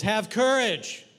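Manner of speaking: loud, drawn-out acted command; voice of Jesus. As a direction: call it out slowly and firmly, lightly in role. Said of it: "you hear a voice".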